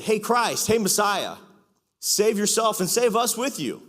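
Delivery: high, loud mocking plea; jeering criminal. Criminal on the cross jeering at Jesus, high loud mockery. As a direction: taunt him to save you both. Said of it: "He said".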